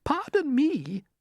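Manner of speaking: high, slow polite murmur; ladybird murmur. Acted. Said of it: "murmured the ladybird".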